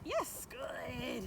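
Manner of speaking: ordinary talk, nothing marked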